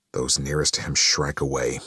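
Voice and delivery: usual speaking voice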